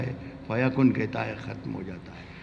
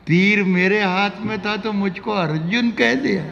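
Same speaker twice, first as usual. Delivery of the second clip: ghazal recitation; 8 semitones higher, 5 dB louder than usual. Acted. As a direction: lift the verse high and let it ring.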